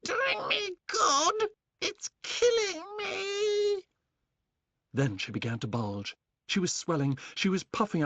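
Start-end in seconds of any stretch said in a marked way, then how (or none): 0.0-3.9 very high, slow wailing crone; old hag crying out. Acted. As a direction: wail in agony like an old witch. Grandma in pain from medicine.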